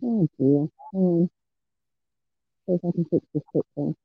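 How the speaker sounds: muffled, churning mic sound; like talking from inside a washer; washing machine sound. Real, not acted. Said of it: "you sound like you're in a washing machine".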